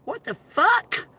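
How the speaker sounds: high, slow, drawn-out what the fuck; shocked disbelief. Real, not acted. She sees her friend dressed like a hooker, in high shocked disbelief. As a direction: squeal it out, scandalized.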